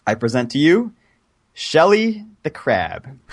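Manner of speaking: slow drawn-out grand reveal; milking the applause. Pretend. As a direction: stretch the big unveiling out. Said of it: "Please hold your applause to the end".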